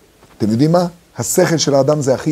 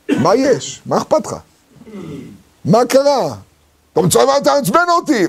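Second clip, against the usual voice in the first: higher, rising annoyance to an outburst; mock irritation; 6 semitones higher than usual. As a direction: play the guy who snaps at the speaker. Acting an irritated interrupter. (קוצר רוח משוחק, עולה לפרץ כעס)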